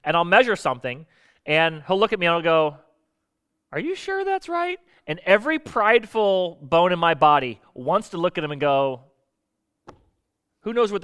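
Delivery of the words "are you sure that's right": doubtful helper. Retold or imitated. Helper doubting his measurement.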